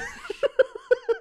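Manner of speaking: sudden high-pitched laugh of disbelief; real startled laugh; burst of laughter. Real, not acted. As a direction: crack up in a high squeal. Disbelief at being told he ate it.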